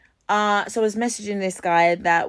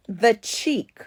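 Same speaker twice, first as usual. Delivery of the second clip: usual speaking voice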